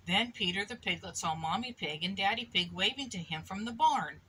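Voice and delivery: ordinary delivery, nothing marked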